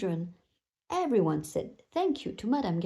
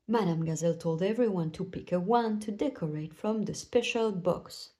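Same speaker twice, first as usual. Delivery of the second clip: usual speaking voice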